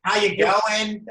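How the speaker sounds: high, drawn-out mock Aussie greeting; imitated Australian accent. Host mimicking Australians' hello, slow high Australian accent. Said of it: "I'm addicted to their accent".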